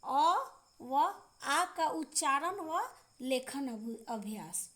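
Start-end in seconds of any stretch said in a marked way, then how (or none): 0.0-0.4 अ said short and clear, a little louder; teacher sounding out अ, louder and short; short vowel demo. Shown as a demonstration. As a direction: say the short अ crisply for the class. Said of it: "जैसे".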